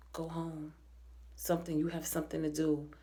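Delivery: hushed, low spell-casting murmur; mock spell voice. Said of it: "the way she says it, it's very obvious that she is casting a spell".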